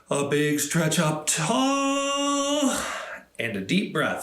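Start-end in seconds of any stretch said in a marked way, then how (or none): 0.1-2.8 high stretch cue. Shown as a demonstration. Leading kids' stretch.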